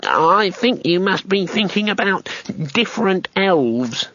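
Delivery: high, slow gnome voice; slowly. A narrator's reading. Gnome corrects Two Flower about elves, slow. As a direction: draw it out carefully. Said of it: "the gnome said slowly".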